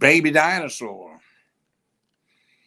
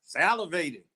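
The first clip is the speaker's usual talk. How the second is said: usual speaking voice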